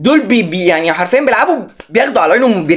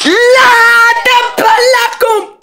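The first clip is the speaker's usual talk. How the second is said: dramatic cry; 3 dB louder, much slower than usual. Real, not acted. Losing again, dramatic cry.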